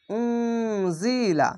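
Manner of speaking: উম জি লা drawn out slowly, syllable by syllable; slow recitation. Shown as a demonstration. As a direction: chant উম জি লা slowly with nasal hum. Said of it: "মাদ্দে আরজি". (ধীরে টেনে উম জি লা)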